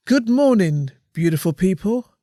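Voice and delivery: high, loud performed read-out; actor mode. Acted. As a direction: act out the post, projecting brightly with theatrical pauses. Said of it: "You went straight into actor mode there".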